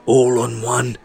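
desperate gambler urging his son; urgent. A narrator's reading. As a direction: hurry him to bet everything. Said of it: "said Red urgently".

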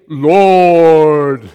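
a loud, ringing call of the name; booming proclamation. Acted. God proclaiming his name to Moses, booming.

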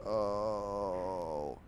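long, fading groan; acted goat groan. Goat lying hit by the dart, drawn-out groan. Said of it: "And the goat's going".

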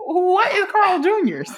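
slow question through laughter; laughing. Real, not acted. Friend laughing in disbelief at a restaurant name, laughing.

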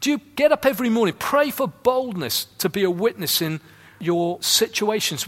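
ordinary talk

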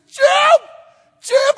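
high, loud yell of a name; wife calling her husband urgently across a little shop, high and loud; wife screaming. Retold or imitated. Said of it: "and she screams".

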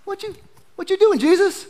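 high-pitched, quick imagined voice; Peter. Acted. Preacher imagines the disciple calling from the boat, high quick voice. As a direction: say it high and fast, a playful impression. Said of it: "Then I see Peter like".

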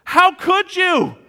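angry disbelief. Retold or imitated. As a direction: explode at dad in disbelief. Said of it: "I got more angry the second time".

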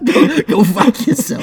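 loud, high, drawn-out Boston accent; hammed-up Boston rage, half laughing; aggressive Boston accent. Shown as a demonstration. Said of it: "every Bostonian is extremely aggressive and violent".

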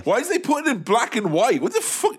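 high-pitched indignant comic rant; mock outrage. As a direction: rant in exaggerated disbelief at the culprits. Mock rant at photo sharers.

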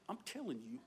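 very soft, low aside; quiet mock insistence; hushed insistence. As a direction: press the point under the breath.

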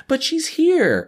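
very high mimicking whine; mock protest. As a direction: parody a naive objection way up high.

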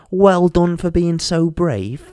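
slow, sneering parody of praise; mock the do-gooder slowly; mocking patronising voice. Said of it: "killer line, mocked".

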